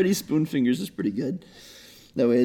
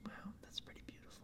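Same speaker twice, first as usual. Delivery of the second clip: breathy hushed whisper; 34 dB quieter, much faster than usual. Real, not acted. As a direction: whisper it in awe. Mock awe at toe sucking.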